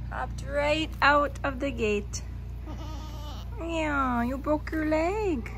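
ordinary talk

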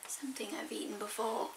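whispered tasting comment; soft unvoiced aside while tasting. Real, not acted.

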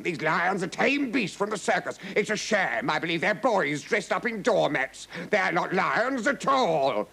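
high, scornful protest; disgusted exclaiming. A narrator's reading. Count dismissing the lions before the king, disgusted. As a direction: exclaim it as an indignant scoffer. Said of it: "he exclaimed".

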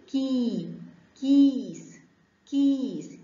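كي and كيس with a long "ee"; drawn-out "kiis" for كيس; long ya sound. Shown as a demonstration. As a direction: stretch the vowel in كيس as for young learners. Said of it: "كاف كسرة مد بالياء".

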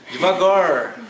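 Devagar said slowly, leaning on a hard final R; stressed R. Shown as a demonstration. Mocking his own accent, devagar with a marked R. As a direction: show the R in devagar clearly. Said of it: "eu não enfatizo os R's".

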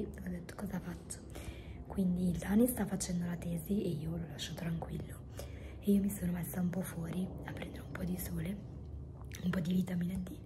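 hoarse breathy whisper; voice giving out. Real, not acted. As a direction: voice gone, whispering. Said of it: "non ho più voce letteralmente".

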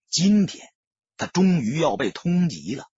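ordinary delivery, nothing marked

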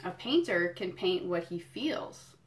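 slow, lilting picture-book read; reading to children. Acted. Museum educator reading a picture book to kids, storytelling. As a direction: savour each item for little listeners.

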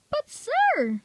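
a sudden very high squeak of protest. A narrator's reading. Ben squeaking high at the Fat Controller. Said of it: "squeaked Ben in an even higher pitched voice".

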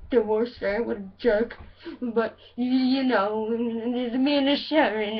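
mumbling, rambling British rocker. Acted. Rocker jealous of [name], whiny rambling impression. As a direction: grumble like a jealous muddled rock star. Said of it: "my [name] impression".

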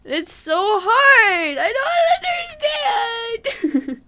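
high, drawn-out laughing wail; exasperated wail. Real, not acted. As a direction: wail it slowly, half laughing, half crying. Exasperated at uncooperative crowd.

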